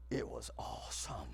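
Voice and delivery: faint, spent, low whisper; exhausted whisper. Retold or imitated. Girl flopped forward after the ride, whispering. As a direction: whisper it with no strength left.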